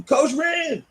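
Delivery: high call across the mall; yelling a name. Retold or imitated. Stranger in the mall spotting the coach, high yell. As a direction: call out to someone far off. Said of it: "I hear somebody yelling".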